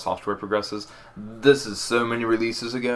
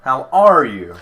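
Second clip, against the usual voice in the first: are made loud and stretched; stress on are; 3 semitones higher, 9 dB louder, slower than usual. Shown as a demonstration. Showing a friend a third way, stress on are. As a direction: lean on are. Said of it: "three different ways".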